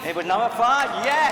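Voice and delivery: high, loud triumphant yes; excited cheer. Real, not acted. Host cheering the winning contestant's number, excitedly. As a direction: cheer the winner out.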